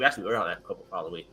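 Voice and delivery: quick, low throwaway correction; fast deadpan aside. Real, not acted. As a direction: rush it out under your breath. Deadpan aside after plan.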